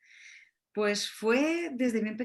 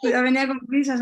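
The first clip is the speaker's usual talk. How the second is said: loud, higher, through real laughter; amused; 5 semitones higher, 9 dB louder, faster than usual. Real, not acted. Guest joining host's joke about her hurried birth, amused.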